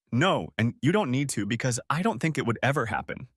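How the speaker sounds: usual speaking voice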